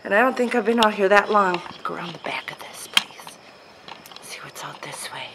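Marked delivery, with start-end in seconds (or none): 2.2-5.3 whispering. Real, not acted. Exploring cemetery, hushed.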